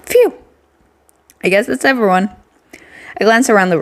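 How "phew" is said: relieved phew. Acted. Relief sigh out loud.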